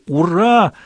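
high, ringing hurrah; joyful cheer. Acted. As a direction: cheer it out gladly. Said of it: "в дружном крике".

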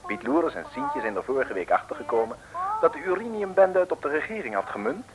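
normal talking voice

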